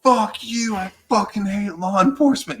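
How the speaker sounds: high, loud mock fury; retold angry outburst. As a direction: imitate a woman cursing out the cops. Retold furious woman confronting police.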